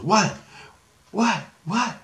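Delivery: put-on high, quick repeated kid questions; eager little kid. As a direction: pipe up like an impatient child. Excited little kid begging to know.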